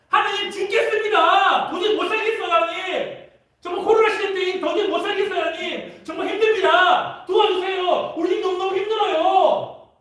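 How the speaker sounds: high, shouted plea for help; desperate outcry. Acted. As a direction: cry out to God in despair. Acted groaning cry to God. (하나님께 절박하게 외치는 소리)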